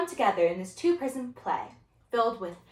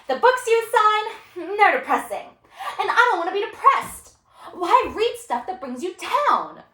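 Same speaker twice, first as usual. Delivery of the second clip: high, loud, indignant student; drawn-out, emphatic student complaint; unruly student; 9 semitones higher, 6 dB louder, slower than usual. Acted. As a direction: protest the reading list with a scornful laugh. Student argues with teacher.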